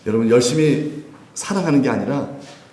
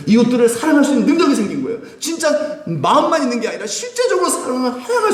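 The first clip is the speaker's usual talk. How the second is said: excited; 12 semitones higher than usual. Real, not acted. Excited preaching climax.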